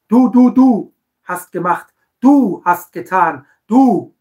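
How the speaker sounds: loud, slow finger-pointing du; accusing du-messages. Retold or imitated. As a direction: hammer each du as a reproach. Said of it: "Immer diese Du-Botschaften".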